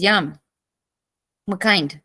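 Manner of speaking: normal talking voice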